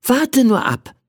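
put-on high, confident child voice; reply with cheerful assurance. A narrator's reading. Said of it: "erwiderte ich zuversichtlich".

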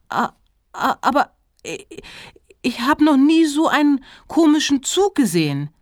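acted flustered girl, tripping into the sentence; flustered stammer. A narrator's reading. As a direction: stumble over the first sounds in astonishment. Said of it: "haspelte Pauline".

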